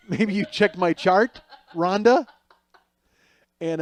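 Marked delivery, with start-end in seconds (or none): 0.1-2.3 louder, slow and pointed; exasperated jab, landing hard on the name; exasperated retort. Retold or imitated. Dizzy patient answering the nurse who asked what's wrong, exasperated.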